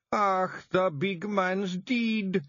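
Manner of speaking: slow, high, wailing grief; little Feagle crying for Rob; sobbing. A narrator's reading. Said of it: "he sobbed".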